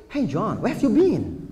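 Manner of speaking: slow, relaxed English greeting; English like a native. Retold or imitated. Preacher showing Indonesians speak English like natives, slow English greeting. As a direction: drawl it like an American. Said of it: "Bahasa Inggris kayak orang Inggris".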